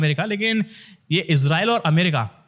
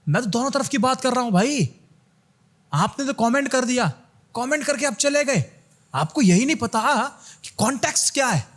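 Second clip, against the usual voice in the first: higher-pitched, exasperated protest; 6 semitones higher than usual. Real, not acted. Creator answering commenters who missed the context, exasperated. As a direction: plead your case to critics, pitched up.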